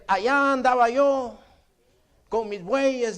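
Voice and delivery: high, loud, stretched opening; proclaiming prophet. Acted. Prophet tells priest of herding oxen, proclaiming loudly. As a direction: declaim it as the herdsman prophet. Prophet recounting his calling, acted.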